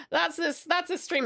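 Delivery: normal talking voice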